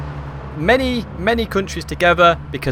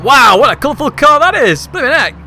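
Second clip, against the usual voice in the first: excited wow; 8 semitones higher, 6 dB louder than usual. Real, not acted. Amazed by colourful car livery.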